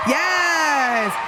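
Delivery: a high, loud burst of joy; excited yes. Real, not acted. Host cheering the renewal to viewers, excited shout. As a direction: cheer it out like great news.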